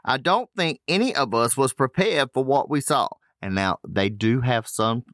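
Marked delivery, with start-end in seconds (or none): 0.0-3.1 bad Australian accent. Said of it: "You just can't do an Australian accent".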